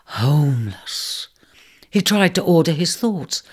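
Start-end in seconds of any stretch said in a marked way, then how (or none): 0.0-1.3 gasped. Acted. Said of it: "he gasped".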